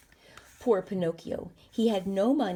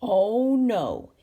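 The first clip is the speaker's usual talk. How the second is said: loud, drawn-out mock refusal; a big booming villain's no; 3 semitones higher, 7 dB louder than usual. A narrator's reading. Puppet master Stromboli refuses to let Pinocchio go, booming. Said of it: "said Stromboli".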